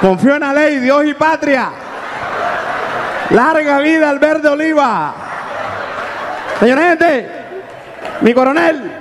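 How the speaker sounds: high, drawn-out calls to a policeman; mock hailing. Heckler calling to the colonel, high mocking hail. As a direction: hail the officer, then hurl the insult.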